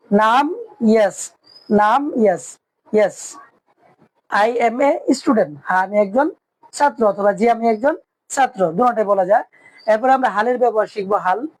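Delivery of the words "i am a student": slow, careful English; slow model answer. Shown as a demonstration. Teacher slowly models the English answer for students. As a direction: lay each word out slowly. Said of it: "ইংরেজি হবে yes".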